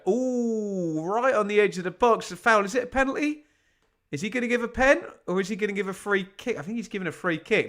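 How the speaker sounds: high-pitched burst of commentary suspense; excited live reaction. Real, not acted. Live reaction to possible penalty.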